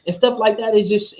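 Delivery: normal talking voice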